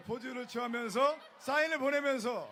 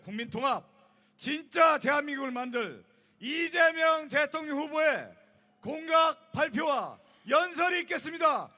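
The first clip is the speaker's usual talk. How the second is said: event MC announcement; 3 semitones higher, 6 dB louder than usual. Acted. MC introduces the candidate.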